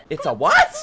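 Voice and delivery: squeaky high, rising in disbelief; disbelieving what. Real, not acted. Streamer hearing the word gibbon, shocked high rise. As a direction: jump way up on what.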